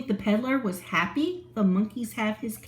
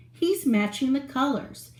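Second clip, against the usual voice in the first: usual speaking voice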